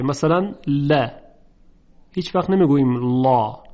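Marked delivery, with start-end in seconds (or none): 0.7-1.1 ل said thin and light, with a front open "la"; light lam. Shown as a demonstration. Teacher showing students the thinned lam, light and delicate ل. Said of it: "صدا نازک و ظریفه". 3.2-3.6 لا said thick and heavy, with a dark back vowel "law"; heavy lam. Shown as a demonstration. Teacher showing what we never say, heavy dark لا. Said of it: "چون لا تفخیم شده".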